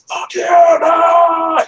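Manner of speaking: high, loud re-enacted outburst; acting out an aggressive yell; aggro shout. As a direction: holler it in defiance, drawn out and high-pitched. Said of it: "I was aggro".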